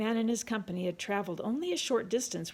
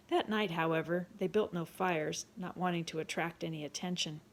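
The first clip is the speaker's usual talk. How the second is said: usual speaking voice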